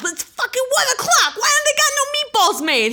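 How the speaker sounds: very high-pitched comic rant; imitated furious disbelief; so mad. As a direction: squawk it like an indignant dad. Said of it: "He was so mad".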